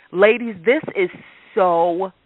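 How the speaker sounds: ordinary talk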